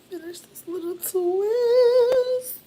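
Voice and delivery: very high, loud and drawn out; long loud squeaky singsong; playful high squeal. Real, not acted. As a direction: stretch it out in a slow falsetto. Playful singsong while doing hair.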